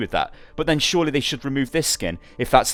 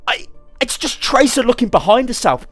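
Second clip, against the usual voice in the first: higher, pushed disbelief; can't believe this is a problem; genuinely baffled rant; exasperated; 9 semitones higher than usual. Real, not acted. Exasperated at complaint.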